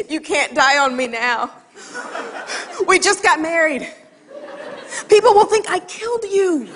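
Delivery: fake-frantic worry with a laugh; laughing mock panic. A new wife panicking on the drive to the ER, half laughing. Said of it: "I'm driving and I'm thinking".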